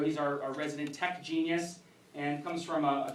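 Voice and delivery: ordinary talk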